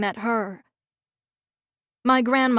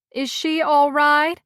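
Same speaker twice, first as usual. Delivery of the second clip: louder, higher and slow, sugary fake worry; false sweet concern; 4 semitones higher, 4 dB louder, slower than usual. A narrator's reading. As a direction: ask loudly, pretending to care. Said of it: "asks loudly with false, sweet concern".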